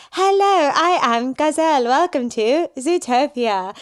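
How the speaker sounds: stiff robotic announcer voice. Retold or imitated. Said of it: "she sounds like a robot".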